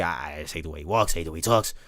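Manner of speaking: lightly done [name] imitation, low and heavy. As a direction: drop way down and channel [name].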